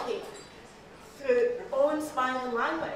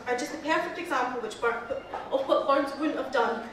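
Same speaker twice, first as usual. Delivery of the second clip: normal talking voice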